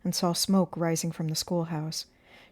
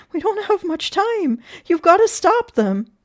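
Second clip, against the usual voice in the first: high, loud, insistent plea; frantic raised voice; desperate urging; 12 semitones higher, 11 dB louder than usual. A narrator's reading. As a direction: beg urgently, stretching the words. Teacher begging sheriff to act.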